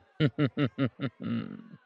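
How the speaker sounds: dark chuckle falling to a hum; wicked chuckle. Acted. Vampire remembers his bloodlust, wicked falling chuckle. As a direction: chuckle wickedly, letting it sink.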